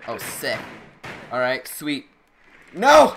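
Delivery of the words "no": sudden high yell; shout. Real, not acted. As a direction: yell it out in panic. Panicked yell at enemy.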